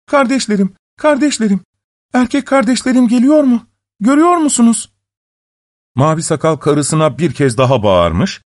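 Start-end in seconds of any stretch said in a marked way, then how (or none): 0.0-4.9 high, urgent woman's call. A narrator's reading. Wife asking her sisters on the walls, high urgent call. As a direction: call anxiously up to someone, pitched high. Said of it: "yine seslenmiş".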